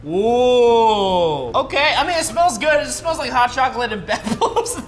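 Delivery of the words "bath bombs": ordinary delivery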